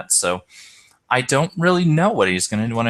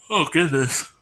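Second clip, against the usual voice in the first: words stretched by a yawn; 4 semitones higher than usual. Real, not acted. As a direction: say it mid-yawn. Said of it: "I'm trying not to yawn here".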